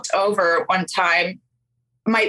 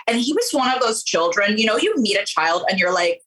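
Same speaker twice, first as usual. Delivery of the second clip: ordinary talk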